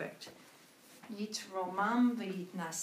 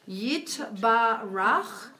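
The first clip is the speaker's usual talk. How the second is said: very slow, louder model reading; slow and deliberate; 6 dB louder, much slower than usual. Shown as a demonstration. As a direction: spell out the whole line slowly. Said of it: "so that whole line".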